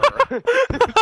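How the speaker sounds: loud high laugh; burst of laughter. Real, not acted. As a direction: crack up laughing. Laughing at own pirate voice.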